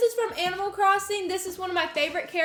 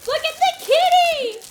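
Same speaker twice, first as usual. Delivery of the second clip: excited squeal; 11 semitones higher, 8 dB louder than usual. Real, not acted. Excited at toy.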